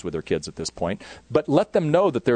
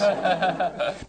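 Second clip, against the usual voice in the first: laugh; 7 semitones higher, 3 dB quieter than usual. Real, not acted. Laughing at his own joke.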